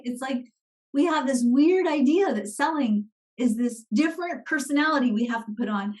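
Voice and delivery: ordinary talk, nothing marked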